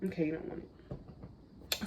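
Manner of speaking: soft, slow whisper; whispered aside. Real, not acted. Mom whispering aside to her baby.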